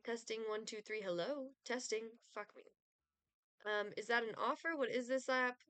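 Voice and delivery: ordinary talk, nothing marked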